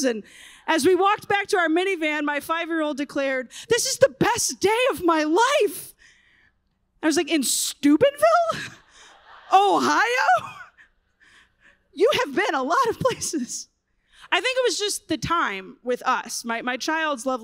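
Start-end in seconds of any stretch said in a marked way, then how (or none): 3.7-5.9 put-on child's declaration. Five-year-old declares. 7.3-13.7 high incredulous squeal with a laughing cry; hammed-up shock at the kid's claim; mock disbelief. Mock disbelief at her child.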